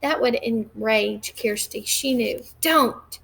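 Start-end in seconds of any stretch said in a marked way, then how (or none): 2.6-3.2 sharp, high angry "Don't". A narrator's reading. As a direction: snap it at him, higher. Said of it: "she said angrily".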